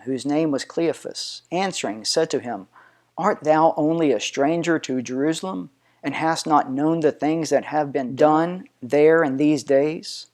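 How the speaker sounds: plain reading voice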